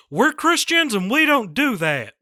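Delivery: high, loud Texan dad voice; bad Hank Hill, high and strained; Hank Hill impression. Shown as a demonstration. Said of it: "this is my Hank Hill".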